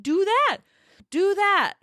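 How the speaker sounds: thrilled insistence; eager yes. Retold or imitated. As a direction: jump at the offer. Eager approval of a free fix.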